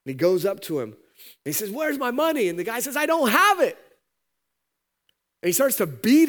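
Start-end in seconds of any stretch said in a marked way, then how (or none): 1.7-2.5 high, loud shakedown; angry demand. Acted. Servant grabs his buddy and demands money, angry. 3.0-3.8 very high, loud protest; desperate plea. Acted. Buddy tells the servant he has no money, high and frantic. Buddy pleading he's broke.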